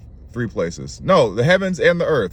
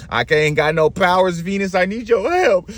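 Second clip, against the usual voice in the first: pleading king; 6 semitones higher than usual. Acted. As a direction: voice climbing into a high plea. Cursed king begs Venus for help.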